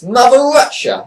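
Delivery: mock a proud Russian villainess with a bad Russian accent. Imitating Lana praising Putin, in a bad Russian accent. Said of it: "And I'm terrible, the accent".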